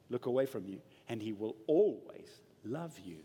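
soft, low, gentle promise; quietly comforting pastor; tender reassurance. Real, not acted. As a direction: lean in and reassure them quietly. Tender assurance to congregation.

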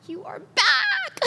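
high, loud joyful cry of welcome; calling out in delight; excited welcome. Retold or imitated. Husband at the airport greeting his wife, joyful cry.

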